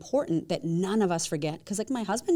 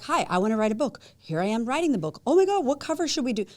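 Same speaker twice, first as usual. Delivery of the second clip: higher, eager chatter; bubbly creator oversharing; excited story post; 5 semitones higher, 4 dB louder than usual. Retold or imitated. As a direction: sound thrilled like an Instagram story. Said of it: "It's like".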